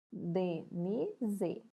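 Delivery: Denise split into DE, NI, SE; syllable by syllable. Shown as a demonstration. Teacher separates syllables for pupils, Denise one piece at a time.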